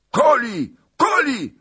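high yelled cry, falling; cossacks yelling. Acted. Cossack mob yelling for the kill, high and loud. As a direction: bellow it as a mob. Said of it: "zawrzasnęli molojcy".

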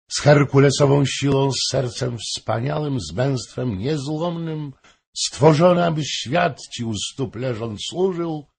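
loud, high, oratorical praise; theatrical courtly oration; declamatory lament. A narrator's reading. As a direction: build a grand speech to the king, slowing at the end. Baron's mock-tragic oration to king.

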